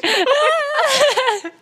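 high, loud shrieking burst of laughter; cracking up with a loud squeal. Real, not acted. Friend cracks up at her co-host's joke, shrieking laugh.

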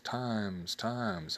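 word bouncing back softly; echo. Shown as a demonstration. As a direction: repeat it like a distant echo. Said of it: "the echo repeats only the last word".

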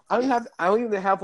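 normal talking voice

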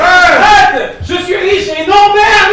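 high, loud, slow shout; yell, dragging it out. Acted. Scene fight climax.

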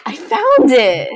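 loud, high triumphant cry; real, sudden excited shout; excited discovery. Real, not acted. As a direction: burst out with the good news. Excited at finding the font.

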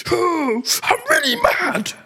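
very high, slow tearful whine; put-on sobbing squeak; mock crying child. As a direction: imitate a kid wailing in a tantrum. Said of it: "he started crying like".

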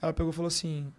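ordinary delivery

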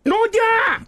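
very high, loud shout of the name; mock jingle call.

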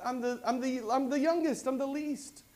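pleading youngest. Retold or imitated. Gideon's excuse voiced high.

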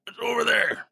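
put-on high hunched old crone. Shown as a demonstration. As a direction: croak it out like a bent old crone. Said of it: "when I've done the old crone voice".

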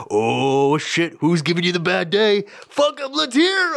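high, hyped-up imitation of a follower; mock eager fan. Online fans jumping on a bad-day post, hammed-up high excited voice. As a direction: egg on the drama way up high, slowing the last bit. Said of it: "They're like".

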